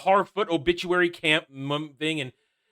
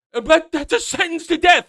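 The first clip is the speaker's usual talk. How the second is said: high, loud mock objection; a hammed-up protest at the sentence; 11 semitones higher, 6 dB louder than usual. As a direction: cry out in fake outrage. Mocking protest.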